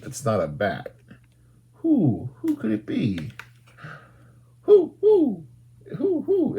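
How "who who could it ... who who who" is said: high, hooting owl calls. Pretend. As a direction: hoot each who like a playful owl.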